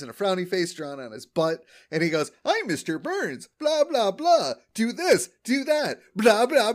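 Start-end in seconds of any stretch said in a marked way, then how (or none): 2.4-6.7 high, silly sing-song blah blah; mock a bossy old man, lightly; mocking Burns impression. Homer mocking Burns for the crowd, high and silly. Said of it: "I have an impression of, of Mister Burns".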